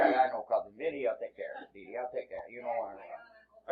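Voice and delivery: low, soft New Jersey tough-guy talk; New York fixer, quietly offering help; New York-New Jersey accent. Acted. New Yorker offers to handle online bullies, low New Jersey accent. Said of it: "I'm from New York, New Jersey".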